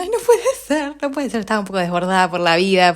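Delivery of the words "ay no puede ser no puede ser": high-pitched mock wail; imitated crying. Overwhelmed owner re-enacts her phone call, mock crying. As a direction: whine it as a hammed-up tearful replay. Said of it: "yo llorando diciendo".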